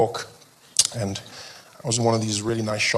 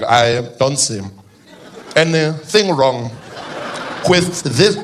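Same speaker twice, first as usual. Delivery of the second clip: slow, chilled-out politician voice; laid-back impersonation with halting gaps; Jacob Zuma; much slower than usual. Acted. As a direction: drag it out, amused and untroubled. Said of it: "he's a chilled out guy".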